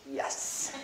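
hushed, unvoiced yes; whispered yes. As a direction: breathe the yes under your breath. Man agrees in whisper.

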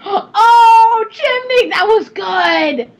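loud, high squeal of approval; delighted exclamation. Real, not acted. Streamer applauding a friend's witty pun, high delighted squeal. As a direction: squeal it, stretching out the last words.

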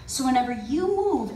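normal talking voice

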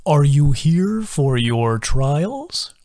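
slow, leaning-in creature voice; slow confiding turtle voice; conspiratorial sing-song. A narrator's reading. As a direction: share it like a secret. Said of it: "leaning in conspiratorially".

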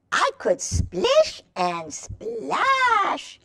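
high, slow singsong splashing; sing-song splashing for kids; playful high voice. Acted. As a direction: paint the splashing with a high stretched voice. Reading picture book to children.

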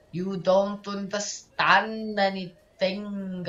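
slow, drawn-out character lines; dramatic dialogue reading. Acted. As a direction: read it out slowly and theatrically.

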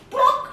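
loud, popped drop-in noise; plop sound. Acted. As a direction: imitate something landing in stew. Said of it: "and she puts".

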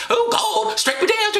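high falsetto mock plea; high-pitched taunt; mocking Mary. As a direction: dare heaven in a high comic voice. Acting Mary daring God.

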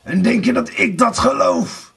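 high, indignant outburst; seething anger. A narrator's reading. Blauwbaard disbelieves his wife, raging. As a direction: throw the question at her angrily. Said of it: "brieste Blauwbaard".

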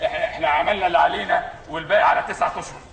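usual speaking voice

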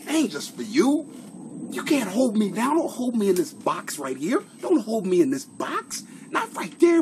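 high, loud comic rant; hammed-up defiance. Streamer refusing to be held in the screen's box, hammed-up defiance. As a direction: keep insisting in a put-on high voice.

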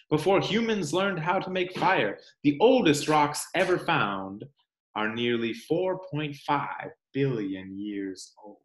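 expressive storybook read-aloud; reading to children. Acted. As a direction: read it like story time for little ones. Reads picture book to children.

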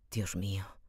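hushed whisper of dread; whispered exclamation. A narrator's reading. Raskolnikov to himself after the nightmare, whispered dread. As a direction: breathe it out in horror. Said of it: "exclamó".

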